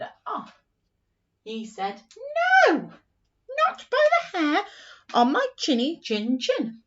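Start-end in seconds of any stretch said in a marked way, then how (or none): 2.7-6.8 high, loud little pig voice; little pig crying out from inside; squeaky pig refusal. Acted. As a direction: shout it back as the frightened pig. Pig's shouted refusal.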